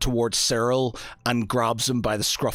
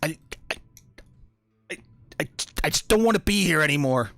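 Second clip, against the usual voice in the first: panicked denial; 7 semitones higher, faster than usual. Acted. Panicked NPC denies, stammering.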